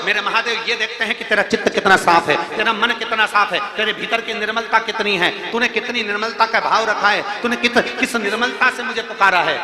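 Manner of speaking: loud, driving sermon cadence; fervent preaching. Acted. Preacher rouses the listeners about the elephant, loud and fervent. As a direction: hammer the point home to the congregation.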